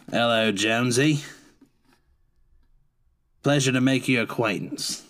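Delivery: put-on a lightly done British accent, polite. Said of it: "It's very British, isn't he?".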